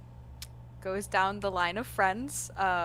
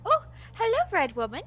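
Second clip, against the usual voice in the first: character greeting; 9 semitones higher, 4 dB louder than usual. Acted. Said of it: "And she's like".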